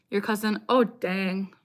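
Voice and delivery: normal talking voice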